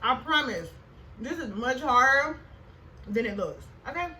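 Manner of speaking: talking while chewing; words round a bite of food; mouth full. Real, not acted. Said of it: "I'm trying to talk at the same time".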